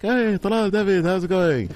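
high-pitched mock-friendly hello; imitated cheery greeting. Scammer greeting his customers, imitated high greeting.

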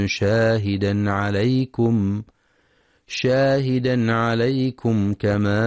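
slow, drawn-out tajweed chant; Quran recitation. Acted. Teacher reciting the verse to listeners, slow tajweed chant. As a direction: recite each phrase slowly and melodically.

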